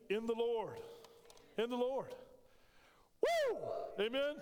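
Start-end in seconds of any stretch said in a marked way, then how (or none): 3.2-3.6 high, loud joyful whoop; excited whoop. Real, not acted. Preacher whooping to the congregation, exuberant. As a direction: let out a real whoop of joy.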